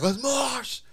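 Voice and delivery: high, drawn-out goofy mosh cry; mock eager mosher. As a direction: imitate an overexcited guy starting a pit. Mimics the mosh-pit stranger.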